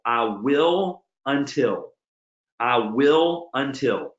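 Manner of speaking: drawn-out words, solemnly spaced; slow, deliberate motto. Retold or imitated. Speaker recites the words on his wall, slow and deliberate. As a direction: say it slowly like a vow.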